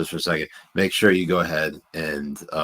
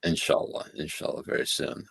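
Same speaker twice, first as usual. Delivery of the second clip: whispered; 6 dB quieter than usual. Real, not acted. Hopeful aside about the timing.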